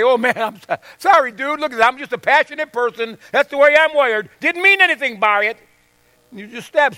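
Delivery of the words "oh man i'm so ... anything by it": raised, breezy mock apology; excuse-maker. Preacher mocking an attacker's casual excuse, raised and breezy. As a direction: shrug it off like a careless stabber. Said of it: "You don't get to say".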